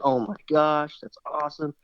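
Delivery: excited exclamation, only mildly shouted; retold excited scream. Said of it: "he just screamed so loud".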